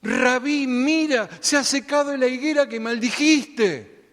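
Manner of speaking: exclamación más aguda y fuerte, llena de asombro; Pedro emocionado. Acted. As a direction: grita asombrado al ver la higuera seca. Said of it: "Estaba emocionado". (Pedro, emocionado, le grita a Jesús al ver la higuera seca)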